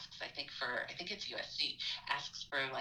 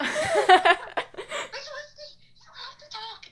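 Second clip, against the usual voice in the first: much higher, louder, slow, laughing yell; laughing panicked yell; 16 dB louder, slower than usual. Retold or imitated. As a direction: shout it through laughter in a mock panic. Student throwing the phone.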